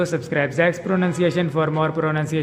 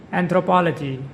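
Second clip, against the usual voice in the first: anthropology with the third syllable punched; stress on third syllable. Shown as a demonstration. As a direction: stress POL in anthropology, as a model word. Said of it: "With the stress on the third syllable".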